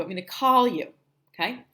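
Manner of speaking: normal talking voice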